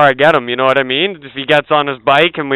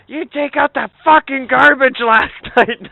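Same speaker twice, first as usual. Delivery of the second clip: much higher hammed-up scolding; mock angry dad; 11 semitones higher than usual. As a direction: fake outrage over the garbage. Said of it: "He's like".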